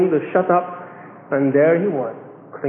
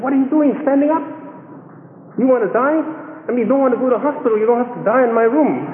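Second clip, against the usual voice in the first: higher-pitched scolding, retold; raised-pitch mock indignation; indignant roommate; 6 semitones higher, faster than usual. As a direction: act out an exasperated friend telling him off. Roommate scolds the sick man.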